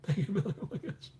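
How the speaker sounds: tiny, high, soft mock squeal; hushed high-pitched fangirl squeal; starstruck. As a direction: pretend to be a starstruck fan. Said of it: "Megan, I'll be starstruck".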